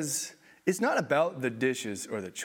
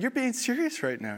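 incredulous; 10 semitones higher, 3 dB louder than usual. Retold or imitated. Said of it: "I was just like".

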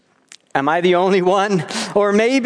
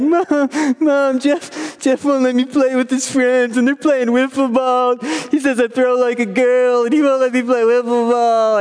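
put-on higher, whining child complaining to mom; whiny little kid; 5 semitones higher than usual. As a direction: whine like a ten-year-old tattling. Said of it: "get my whiny voice on".

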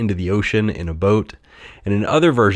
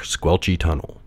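usual speaking voice

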